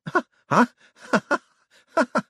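high, mad staccato laughter; shrieky nervous ha-ha-ha; hysterical laugh. A narrator's reading. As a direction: laugh helplessly in horror. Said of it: "Hubert stared in horror at Moist".

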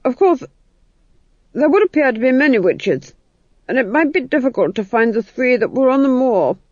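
high, hesitant excuse; uneasy Duke. Acted. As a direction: offer objections nervously to a fierce wife.

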